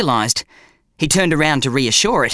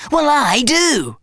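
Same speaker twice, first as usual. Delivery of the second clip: stubborn bum; 11 semitones higher, slower than usual. A narrator's reading. Bum answering quarrel.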